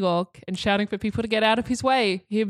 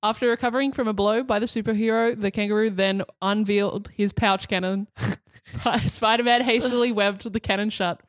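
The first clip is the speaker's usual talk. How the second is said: usual speaking voice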